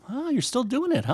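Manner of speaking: high-pitched put-on encouragement; feigned polite surprise. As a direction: humor them with a high, surprised lilt. Mock reaction to weaker students.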